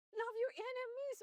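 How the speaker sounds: high, startled cry of the verse; shocked exclamation. Retold or imitated. Preacher reliving her shock at the verse, startled. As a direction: shout it as if hit by news.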